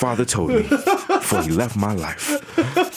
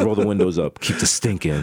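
low sly order; 8 semitones lower than usual. Retold or imitated. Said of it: "I was like".

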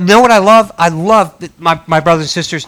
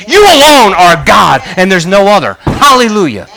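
preaching shout; 3 semitones higher, 6 dB louder than usual. Real, not acted. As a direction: proclaim it at full force. Climactic preaching declaration.

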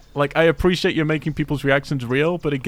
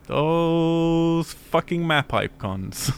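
drawn-out exasperation; 5 semitones higher, much slower than usual. Real, not acted. Griping about game map icons.

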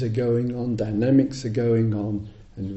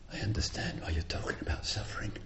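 whispered realization; 4 semitones lower, 14 dB quieter, faster than usual. Retold or imitated. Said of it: "she said".

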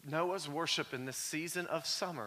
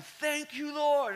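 retold yelling; 9 semitones higher, much slower than usual. Preacher reliving crying out to God while driving, yelled high. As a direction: cry it out high to the Lord. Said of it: "I'm yelling".

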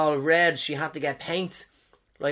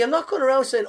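ordinary talk, nothing marked

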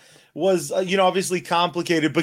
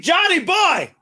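calling out; 10 semitones higher, slower than usual. Real, not acted. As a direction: hail him like a buddy. Greeting friend on podcast.

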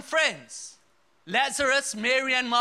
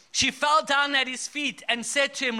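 ordinary delivery

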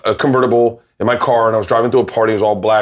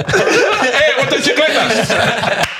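laughing; 12 semitones higher, much slower than usual. Real, not acted. Laughing while fumbling the word.

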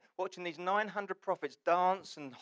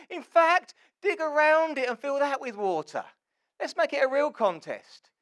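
high, loud, escalating bravado; cocksure; 11 semitones higher, 8 dB louder than usual. Acted. Elijah upping the contest before the crowd, cocksure. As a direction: shout the challenge with full confidence. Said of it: "The confidence of the man".

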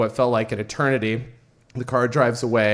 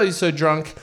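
ordinary delivery

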